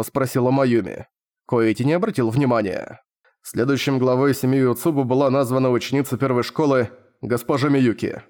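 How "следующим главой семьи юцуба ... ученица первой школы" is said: ordinary delivery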